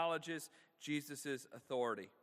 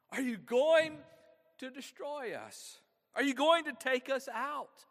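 raised, loud, urgent questioning; demon's challenge; 8 semitones higher, 7 dB louder than usual. Acted. Demon confronting Jesus about being destroyed, raised and challenging. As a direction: challenge him, pitched high and loud.

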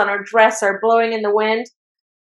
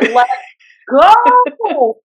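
much higher and louder, a choked heroic shout; heroic cry; 4 semitones higher, 4 dB louder than usual. Retold or imitated. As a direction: cry it out as the hero leaps in. Dramatic anime line, voiced.

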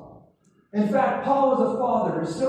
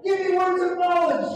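higher, shouted cry; crying out for words of knowledge; shouted prayer; 6 semitones higher than usual. Shown as a demonstration. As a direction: shout it to heaven. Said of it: "prayer line led for congregation".

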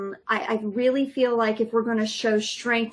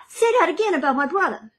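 fast, higher Boston threat; Boston tough guy; 6 semitones higher, 3 dB louder, much faster than usual. Retold or imitated. Mom quoting a Mark Wahlberg line in quick Boston accent. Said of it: "No, I got".

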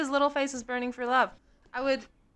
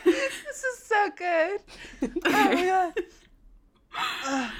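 laughing so hard it's nearly crying; high, slow words through laughter; 8 semitones higher, 4 dB louder, much slower than usual. Real, not acted. Cracking up at the Blorbo take.

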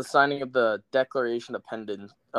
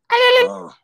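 surprised; 11 dB louder than usual. Real, not acted. Loud high exclamation.

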